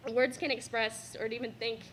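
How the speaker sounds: ordinary delivery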